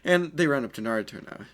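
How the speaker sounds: normal talking voice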